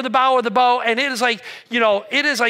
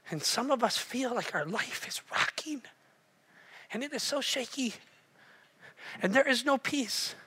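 very soft, intimate delivery; hushed preaching; 10 dB quieter than usual. Real, not acted. Preacher comforting those whose life is rocking, hushed. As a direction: drop right down to a tender hush.

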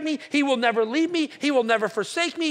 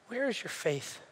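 quiet reproach; 2 semitones lower, 10 dB quieter than usual. Retold or imitated. Jesus to frightened disciples, quiet reproach. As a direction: ask it softly in one run.

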